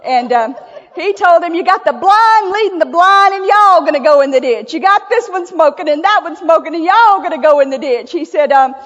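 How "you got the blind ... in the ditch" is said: louder, higher, a retold pastor railing at his church; mock railing. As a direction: rail at them like a fired-up preacher. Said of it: "just railed them about smoking".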